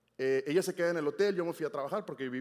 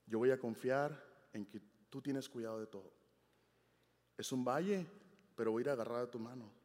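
quiet retold prayer; 4 semitones lower, 5 dB quieter than usual. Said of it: "Yo decía".

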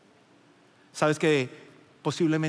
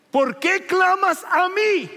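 voice of God; 7 dB louder, slower than usual. Acted. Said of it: "Pero el Señor dijo a Moisés".

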